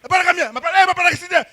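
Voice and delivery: calling someone over, insistent and loud; pushy Xhosa guy. Retold or imitated. Xhosa guy calling his brother over, loud and insistent. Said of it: "a Xhosa guy comes".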